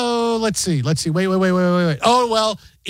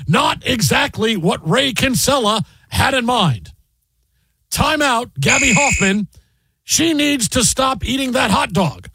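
slow, higher announcer commentary voice; measured mock play-by-play, pitched up; sportscaster impression; 5 semitones higher, slower than usual. Host mock-calling the movie as sportscaster, slow announcer impression. Continues the Marv Albert impression.

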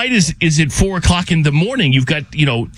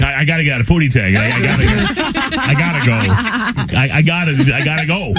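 laughing panic; 3 semitones lower than usual. Retold or imitated.

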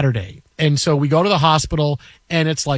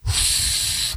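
soft voiceless exhale of frustration; imitated frustrated sigh; 6 dB quieter than usual. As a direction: blow the air out, deflated. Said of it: "and he goes".

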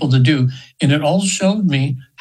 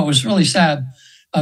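ordinary talk

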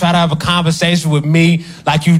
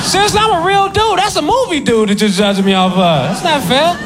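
whiny protest; 10 semitones higher than usual. Acted. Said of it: "pleading complaint".

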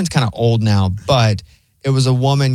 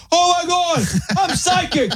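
triumphant psychic joy; slower than usual. Retold or imitated. Retelling jumping up triumphant.

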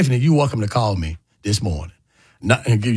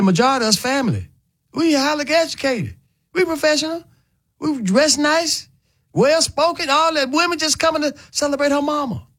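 higher, proud mock-quote of a woman defending her group; voicing Tiffany; 13 semitones higher than usual. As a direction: lift the voice high and play her proud insistence.

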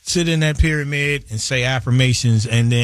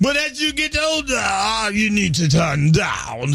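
hype shout; 7 semitones higher than usual. Acted. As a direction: stretch it out like a party hype man. Continues his comic hype-man yell.

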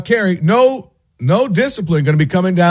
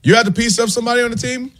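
surprised question; 8 semitones higher, faster than usual. Real, not acted. Asking his co-host if he fought a teammate, high and surprised. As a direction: pitch jumps way up, fast and amused. Teasing teammate about a fight.

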